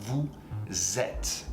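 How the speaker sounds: "Vous êtes" linked with a z sound, said "vou-zêtes"; liaison demo. Shown as a demonstration. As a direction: carry the S of "Vous" into "êtes" as a clear z. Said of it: "there is a liaison between vous and êtes".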